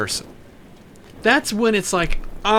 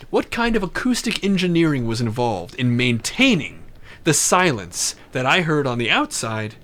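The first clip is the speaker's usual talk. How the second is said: ordinary delivery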